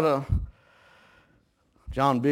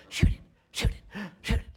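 whispered shoot it, son urging; hushed urging; 6 semitones higher than usual. Retold or imitated. Boy whispering to his dad at the deer stand, urgent whisper.

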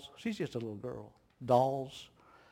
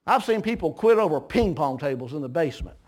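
usual speaking voice

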